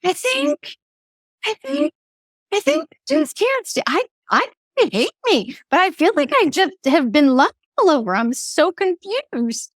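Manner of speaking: high, bewildered inner voice; mock-confused thinking aloud, pitched high; confused younger self. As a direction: sound lost and puzzled. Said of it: "And I remember thinking".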